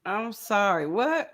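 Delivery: ordinary delivery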